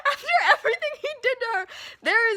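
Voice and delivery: fast, high, laughing words; laughing through tears. Real, not acted. Overjoyed fan gushing about Brenda, laughing tearfully. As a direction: blurt it out half laughing, half crying. Laughing, emotional over the scene.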